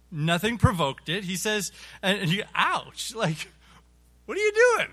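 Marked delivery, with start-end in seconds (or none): 2.5-4.9 mock pained yelp rising into a high squeal; exaggerated, high, loud outrage at being hit; startled protest. New convert wallops you out of nowhere, startled mock protest.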